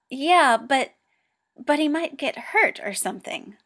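flustered, rising and loud. A narrator's reading. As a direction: stumble over it in a panic. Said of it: "flails Hikaru".

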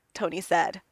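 plain reading voice

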